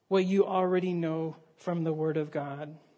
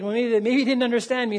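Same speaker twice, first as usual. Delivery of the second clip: voiced a bit louder and quicker; captain calling over the radio, louder; mild shout; 4 semitones higher, 6 dB louder, faster than usual. A narrator's reading. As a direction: raise the voice, puzzled and hurried. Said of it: "he shouted back".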